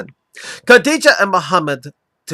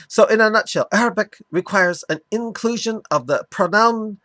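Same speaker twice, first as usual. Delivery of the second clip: mock Arabic accent. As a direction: lecture it in a hammed-up Arabic accent. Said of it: "continue doing my mock Arabic accent for him".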